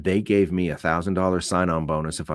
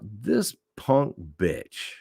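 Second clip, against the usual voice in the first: contempt; much slower than usual. Retold or imitated. Contemptuous inner thought.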